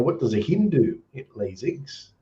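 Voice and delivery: badly faked Kiwi accent, trailing off softly; mock New Zealand accent, eggs squeezed toward "iggs"; terrible Kiwi accent. Shown as a demonstration. Aussie telling a joke about Kiwis, in a bad Kiwi accent. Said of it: "terrible Kiwi accent".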